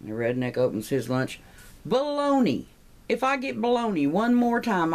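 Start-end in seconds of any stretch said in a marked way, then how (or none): 1.9-4.9 high-pitched mock outrage; hammed-up lunchtime disgust; exasperated complaint. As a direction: play it fed up, voice pitched way up. Acted fed-up lunch complaint.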